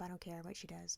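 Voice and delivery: whispered aside. Retold or imitated. Parent whispering to the babysitter so the kid won't hear.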